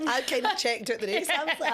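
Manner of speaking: ordinary talk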